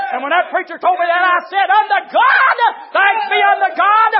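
shouted, high-pitched praise building to a long cry; full-force preaching. Real, not acted. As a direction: holler it out to the congregation.

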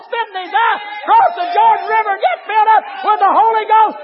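high, loud shouted preaching; full-force preaching. Acted. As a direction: preach it hard, raising the roof.

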